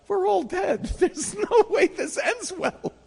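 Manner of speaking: high, laughing, slow mock despair; laughing doom. Teller joking to friends they're doomed, laughing and high. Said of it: "I'm like".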